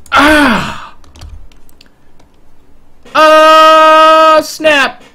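high, loud startled yelp; startled shout. Real, not acted. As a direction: yelp in shock at the news. Startled game outburst.

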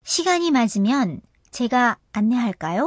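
안내할까요? ending with a steep jump up on the last syllable 요; sharp final rise. Shown as a demonstration. Teacher demonstrating the yes-no question rise to learners, steep rising end. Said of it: "最後は急激に高く上げて発音し".

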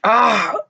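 loud, high cry of shock; startled yell. A narrator's reading. Ron hit by a water balloon, startled yell. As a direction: yelp as if suddenly drenched.

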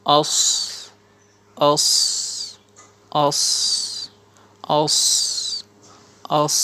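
heavy "os" with a long s; "os" repeated singly, with pauses; drilled sad "os". Shown as a demonstration. Teacher shows alif zabar sad jazm, drilling "os". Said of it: "আলিফের জবর সদের জজম".

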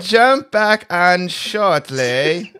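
high, slow, hammed-up British villain; maniacal evil genius. Reading a friend's message as a mock British supervillain. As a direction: drawl it like a sixties movie mastermind. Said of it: "like a maniacal evil genius".

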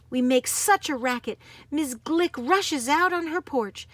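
usual speaking voice

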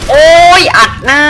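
high, loud gamer whoop; thrilled kill callout; excited shout. Real, not acted. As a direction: yell out the headshot in delight.

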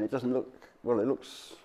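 ordinary delivery

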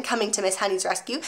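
plain reading voice

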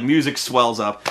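ordinary talk

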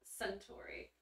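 hushed whispered Suntory. Real, not acted. Repeats the whiskey brand name.

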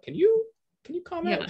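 high, bright prodding teacher voice; Zoom teacher voice. Retold or imitated. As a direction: coax the class to talk. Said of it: "Zoom teaching is a lot about".

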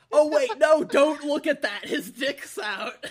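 loud, high mock-frantic warning; retold panic. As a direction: imitate a friend's alarm, breaking into laughter. Retold frantic warning, too late.

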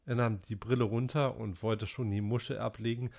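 ordinary delivery

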